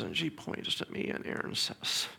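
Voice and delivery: ordinary talk, nothing marked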